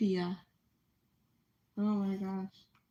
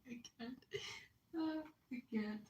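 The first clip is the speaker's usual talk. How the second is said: stifled giggles; 3 semitones higher, 7 dB quieter than usual. Real, not acted. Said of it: "I'm trying not to laugh".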